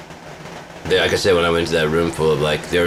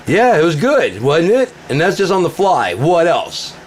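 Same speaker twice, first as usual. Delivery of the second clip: high, loud, cocky; gloating shout; 12 semitones higher, 5 dB louder than usual. Retold or imitated. Shouting down the trolls in the room, cocky and loud. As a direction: crow it at him.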